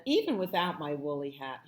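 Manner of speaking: usual speaking voice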